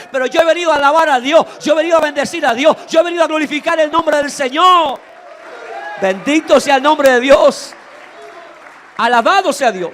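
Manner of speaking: loud, fast, fervent preacher's cry; full-force preaching. Acted. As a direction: preach it out over the congregation.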